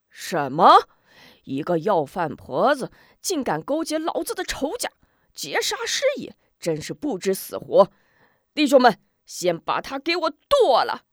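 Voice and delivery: loud, enraged bandit chief barking orders; rally the gang to attack; furious command. Acted. Chief orders his men to kill.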